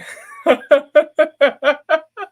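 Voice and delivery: high, loud laugh breaking through tears; teary laugh. Real, not acted. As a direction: laugh it off while choked up.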